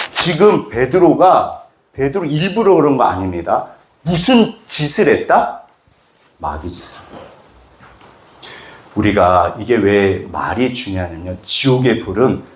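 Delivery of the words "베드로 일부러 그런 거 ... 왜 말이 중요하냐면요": ordinary delivery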